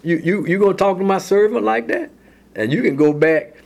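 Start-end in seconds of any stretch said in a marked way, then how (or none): 0.0-2.1 high-pitched, incredulous questioning as God; indignant challenge. Acted. God confronts those who challenged his servant, indignant. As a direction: challenge them, voice climbing high.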